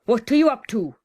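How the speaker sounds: loud bellow of a name; a man shouting up the stairs. Acted. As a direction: call her sharply from downstairs. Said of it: "a man's voice shouted".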